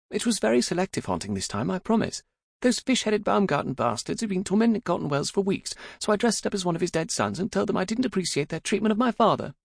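high, feisty daughter voice; high-pitched, unrepentant young woman; spirited young daughter. A narrator's reading. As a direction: plead your case to Papa, pitched well up.

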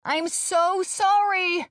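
high, drawn-out yelled apology; yelling out. A narrator's reading. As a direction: call it out slowly across a distance. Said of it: "and yell out".

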